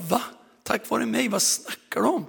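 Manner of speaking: high, puzzled disbelief; baffled. Retold or imitated.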